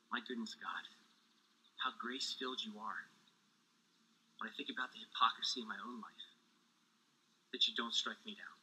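soft, low, reverent address to God; hushed awe. Acted. Preacher confesses to God how undeserved grace is, hushed awe.

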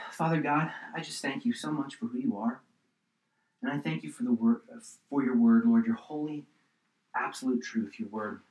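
soft, unhurried prayer voice; closing prayer. Real, not acted. Pastor praying over the congregation, softly. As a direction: pray gently for the listeners.

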